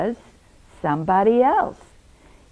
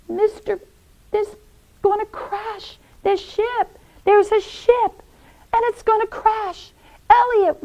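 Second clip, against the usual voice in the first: high, urgent pleading voice; alarmed high-pitched plea to a friend; panicked Pete; 12 semitones higher than usual. Acted. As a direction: cry for help in a much higher voice. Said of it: "And Pete said to him".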